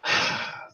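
long unvoiced sigh; weary sigh. Real, not acted. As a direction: breathe out in shame first. Before admitting Burger King.

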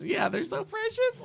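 very high-pitched gushing; mock cooing. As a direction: squeal it as if cooing over little kids. Mock cooing over young musicians.

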